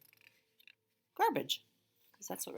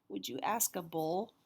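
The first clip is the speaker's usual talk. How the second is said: ordinary delivery, nothing marked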